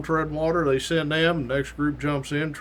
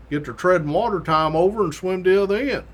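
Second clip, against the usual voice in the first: normal talking voice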